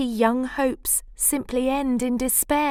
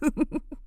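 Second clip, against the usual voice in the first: soft laugh; 7 semitones higher, 3 dB quieter than usual. Acted. Laugh after proclamation.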